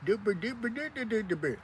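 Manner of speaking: high, soft, put-on churchy voice mumbling a prayer; mock posh prayer voice. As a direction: pretend to be a prim holy person praying. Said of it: "talking in some weird accent like you [name]".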